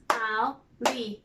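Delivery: "Alligator" as four even beats; split into syllables. Shown as a demonstration. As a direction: chop "Alligator" into al, li, ga, tor. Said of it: "Four syllables".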